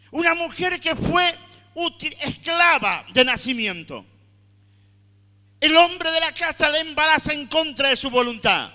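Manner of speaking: loud, impassioned sermon cry; preaching at full force. Acted. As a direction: preach it hard to the congregation. (predicación a todo pulmón)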